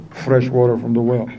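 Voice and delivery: ordinary delivery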